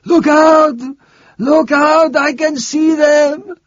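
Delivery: terrified priest yelling a warning; delirious shouting. Acted. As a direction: warn everyone the devil is coming. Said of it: "He was shouting loudly now".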